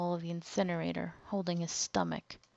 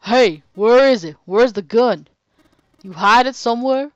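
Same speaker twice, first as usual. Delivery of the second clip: much louder roaring demand; 4 semitones higher, 15 dB louder than usual. Acted. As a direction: roar it at him, grabbing his collar. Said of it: "Seven grabbed him by the collar and roared".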